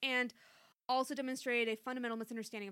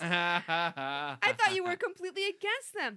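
giggling through the line; laughing; 4 semitones higher, 8 dB louder, slower than usual. Real, not acted. Reader laughing at Chrissy's Monsanto question. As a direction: read through laughter, slow.

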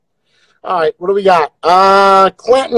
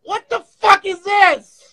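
shocked shout; 11 semitones higher than usual. Real, not acted. Shout at filter.

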